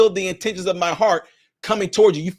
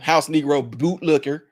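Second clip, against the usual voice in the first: ordinary delivery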